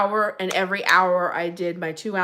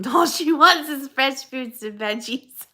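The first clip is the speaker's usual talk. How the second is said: laughing; 6 semitones higher, 4 dB louder than usual. Real, not acted. Laughing while reading viewer comment.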